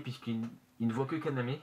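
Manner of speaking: usual speaking voice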